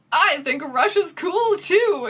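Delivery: lightly mocking slow boyfriend voice; dopey slow drawl. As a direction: stretch it out with a goofy sincerity. Draco's boyfriend voiced in recap.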